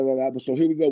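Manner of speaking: normal talking voice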